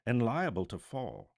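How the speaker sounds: loud, pleading objection; object, fearful of falling; remonstrating. Acted. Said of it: "Scrooge remonstrated".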